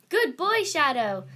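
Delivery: high, loud cheer to a horse; yelled praise. Acted. Luna praises her galloping horse, yelled. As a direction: call out delighted praise from the saddle. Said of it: "Luna yelled".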